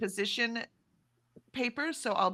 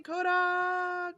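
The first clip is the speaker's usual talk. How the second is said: high-pitched call across a valley; calling from a hilltop; 11 semitones higher than usual. Pretend. Greeting a faraway viewer by yodeling her name, high call. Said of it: "like I'm high on a hilltop".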